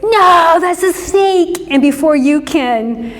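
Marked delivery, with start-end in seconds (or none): 0.0-1.7 put-on a dad warning his child about a snake; alarmed dad. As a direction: cry out the danger to the child, then slow down. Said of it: "Your dad goes".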